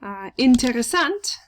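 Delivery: normal talking voice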